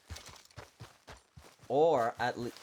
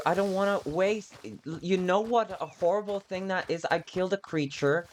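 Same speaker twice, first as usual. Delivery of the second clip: upset and worked up; 9 semitones higher than usual. Real, not acted. Upset about wasting the kill.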